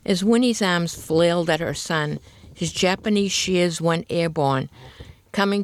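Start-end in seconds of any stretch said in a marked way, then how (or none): none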